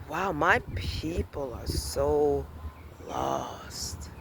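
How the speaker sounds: low, slow, fading to a whisper; hushed dismay. Real, not acted. Realizing her people are lost, hushed dismay. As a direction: let it sink away into a stunned whisper.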